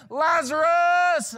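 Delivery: very long, high shout; resounding call. Acted. Jesus' call reaches Lazarus feasting in heaven, resounding shout. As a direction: send the name ringing across a great distance. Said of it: "he hears coursing across the courts of heaven".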